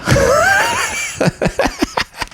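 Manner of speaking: sudden big high laugh; burst of laughter. Real, not acted. Laughs at losing his thought.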